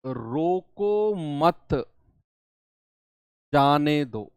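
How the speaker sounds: "रोको मत" drawn out as one unit, long pause, then "जाने दो"; pause after मत. Shown as a demonstration. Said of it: "मैंने कॉमा बीच में लगा दिया".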